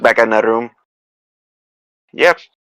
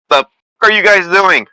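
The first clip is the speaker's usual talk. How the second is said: high, raised yell to companions; 9 semitones higher, 4 dB louder than usual. Acted. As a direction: call out in a high-pitched demanding yell. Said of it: "I yell out".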